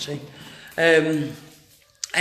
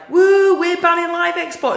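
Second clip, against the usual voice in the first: high whooping imitation of celebrating protesters; mock cheering; 11 semitones higher, 5 dB louder than usual. Mimicking protesters celebrating the ban, mock cheering. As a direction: whoop it up mockingly, far higher.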